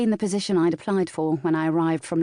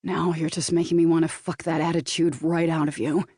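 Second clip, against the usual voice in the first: put-on Californian accent. A narrator's reading. Boyfriend answering partner's huff, light Californian accent. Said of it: "his Californian accent".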